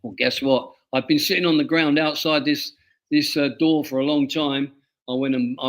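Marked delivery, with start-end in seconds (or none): none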